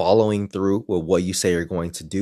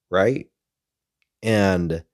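normal talking voice

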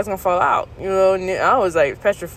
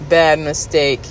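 ordinary talk

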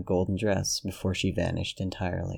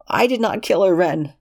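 pitched-up, insistent protest; high denial; 11 semitones higher, 6 dB louder than usual. Acted. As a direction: deny it in a high strained voice. Lexington denying the killing.

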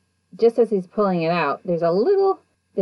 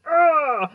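grunt; 10 semitones higher, 4 dB louder than usual. Retold or imitated. Lunging as if to punch.

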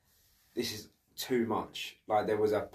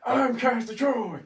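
mock Nicolas Cage yell; 13 semitones higher, 5 dB louder than usual. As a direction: scream it like an unhinged overactor. Quoting Cage's overacted movie line.

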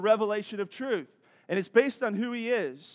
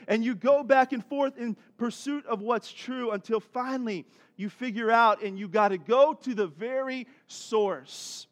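ordinary talk, nothing marked

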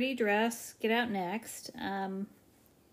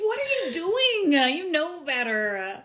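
scolding a bad dog; 9 semitones higher, 8 dB louder, slower than usual. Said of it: "treat her like she's a, an, a dog that's misbehaving".

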